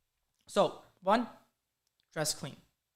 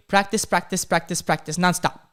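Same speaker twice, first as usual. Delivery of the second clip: loud, run-together repeats of "practice"; nonstop repetition; 7 dB louder than usual. Real, not acted. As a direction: drum "practice" in without a break.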